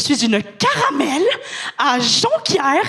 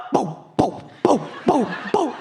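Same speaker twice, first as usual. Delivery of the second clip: mock beating noises; 6 semitones lower, 3 dB quieter than usual. Imitating getting beaten up.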